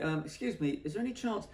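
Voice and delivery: high, tentative polite request; hesitant favour-asking. Retold or imitated. Photographer asking at the cabbies' kiosk, high and tentative.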